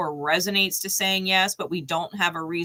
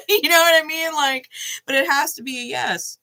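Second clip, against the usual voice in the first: laughing; 8 semitones higher, 5 dB louder than usual. Real, not acted. Laughing after the story.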